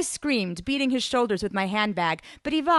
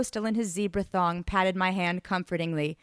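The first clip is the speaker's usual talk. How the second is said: ordinary delivery, nothing marked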